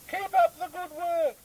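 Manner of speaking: very high, squeaky, whiny complaining voice; a squeaky, whiny politician who keeps forgetting his own name; squeaky opposition leader. Acted. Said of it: "squeaky voice".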